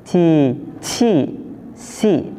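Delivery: "Ci, ci, si" with sibilant hiss on each onset; slow, spaced "Ci, ci, si" with hissing starts; hissed consonants. Shown as a demonstration. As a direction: hiss through "Ci, ci, si", second "ci" puffed. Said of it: "Ada desisnya".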